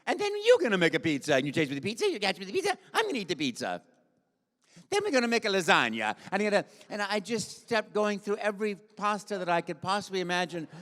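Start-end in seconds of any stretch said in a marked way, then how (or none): 0.0-6.1 high, loud Italian cartoon voice, rattling on; Mario. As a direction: keep improvising as an excitable Italian plumber. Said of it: "so I went".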